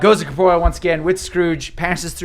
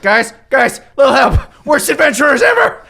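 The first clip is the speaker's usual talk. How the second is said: loud, higher panicked plea for help; Scrooge calling for help; 9 semitones higher, 8 dB louder than usual. Acted. GM voicing Scrooge shouting at the party for help. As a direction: yell it at the useless heroes. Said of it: "and he's like".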